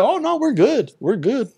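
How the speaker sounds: lightly mocking a too-easy coach saying all is fine; mock easygoing coach.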